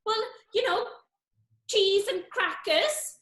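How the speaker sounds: a lightly put-on South Wales accent; lilting South Wales Brenda, worried. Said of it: "that's 'cause I'm from South Wales".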